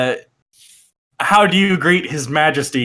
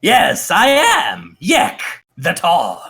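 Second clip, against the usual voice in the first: self-important king; 4 semitones higher than usual. Acted. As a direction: stretch each word like His Majesty proclaiming himself. Game master voices the self-styled king.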